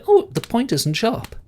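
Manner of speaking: put-on wondering child. [name] feeling the horn's tip, high and wondering. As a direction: marvel like a little girl.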